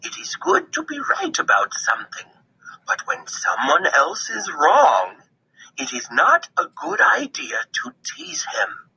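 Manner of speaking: wise grandmother. A narrator's reading. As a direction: gently warn like a wise old grandmother.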